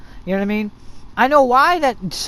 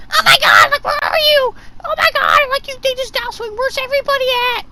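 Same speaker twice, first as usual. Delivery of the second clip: very high, shrieky teammate imitation; squealing put-on panic; mock panicked yelling; 5 dB louder than usual. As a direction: wail it like a hammed-up player in distress. Mimics panicking teammates in a match.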